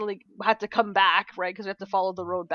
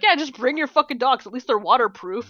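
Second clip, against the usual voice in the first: louder and higher, pushy; a sister pressing the point, loud and sure; emphatic retold advice; 4 semitones higher, 6 dB louder than usual. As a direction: insist on it, swearing for emphasis. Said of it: "she was like".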